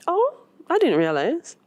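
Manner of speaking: a light startled reply; surprised Maribel. Acted. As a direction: caught off guard, lifting the pitch. Maribel voiced in story.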